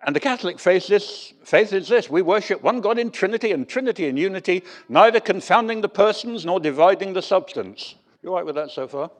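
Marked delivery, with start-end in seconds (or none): 0.0-8.0 higher, louder, declaiming the creed like a stern proclamation; solemn creed warning. Retold or imitated. The preacher reading the Athanasian Creed to the congregation, solemnly declaimed. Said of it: "starts off with a bit of a warning".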